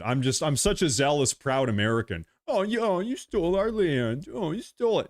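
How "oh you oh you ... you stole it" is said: high, whiny mock complaint; mocking whiner. Streamer mocking critics who say you stole our land, high whine. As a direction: sneer it in a silly high voice. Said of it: "mocking opponents' complaint".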